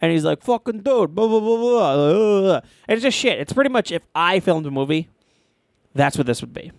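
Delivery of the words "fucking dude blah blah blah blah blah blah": hammed-up Brooklyn Italian tough guy; a loose Brooklyn Italian soldier; Brooklyn Italian accent. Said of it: "so Brooklyn Italian".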